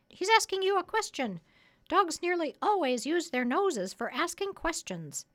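high, loud parrot voice. Acted. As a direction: pitch it up high like a lecturing parrot.

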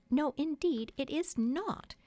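high-pitched, emphatic "it is not"; frightful emphasis. A narrator's reading. Wife to boy about a rival, emphatic "it is not". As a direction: hit "it is not" hard, pitched well up. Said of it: "replied with frightful emphasis".

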